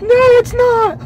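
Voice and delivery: very high, loud, slow protest; mock hysterical contradiction; imitating freaked-out passenger. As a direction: wail it, imitating a panicked rider. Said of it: "throwing her hands up and freaking out".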